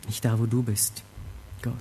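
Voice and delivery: soft, low, solemn echo; quiet inner thought. Acted. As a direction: let it sink in, low and hushed. Stille Wiederholung der Erkenntnis. (leise, tief und ernst)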